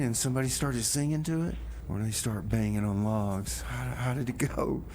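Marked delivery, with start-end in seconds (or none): none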